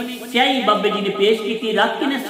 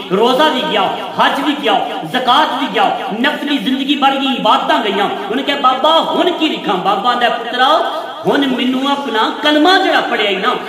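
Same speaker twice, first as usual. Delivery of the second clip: preaching at full force; 2 semitones higher, 4 dB louder than usual. Acted. Preacher builds the story to its climax, loud and emphatic. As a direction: drive the story home to the congregation.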